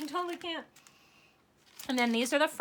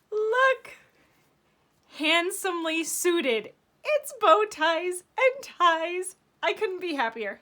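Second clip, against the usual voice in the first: excited; 7 semitones higher, 7 dB louder, slower than usual. Real, not acted. Showing the new stamp set.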